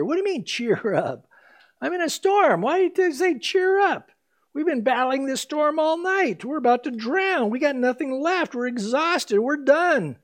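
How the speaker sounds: a hammed-up cry of exhausted men; desperate disciples. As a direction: speak as the disciples, overwhelmed in the storm.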